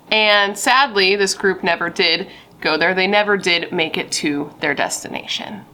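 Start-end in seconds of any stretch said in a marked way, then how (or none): none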